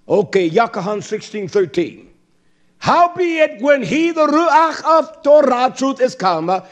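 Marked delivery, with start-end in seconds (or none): 2.8-6.6 fiery preaching. Acted. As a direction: lift the congregation with each line. Reading scripture at full force.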